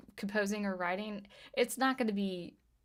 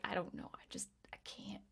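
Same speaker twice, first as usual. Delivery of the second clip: hushed, shocked whisper; breathless whisper of discomfort; 11 dB quieter than usual. Retold or imitated. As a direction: whisper it like you can't bear to look. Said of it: "I'm like".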